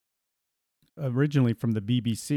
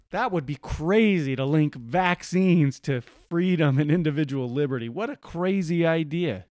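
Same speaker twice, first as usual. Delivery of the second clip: mock disbelief; 4 semitones higher, 3 dB louder than usual. Host feigning shock at the article, sarcastic disbelief. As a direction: play astonished at an obvious absurdity.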